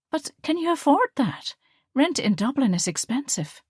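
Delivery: worried, voice lifted high; concerned young woman. A narrator's reading. Liesl worried.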